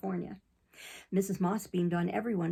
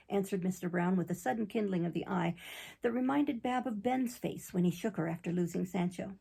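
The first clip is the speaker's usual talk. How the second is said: ordinary delivery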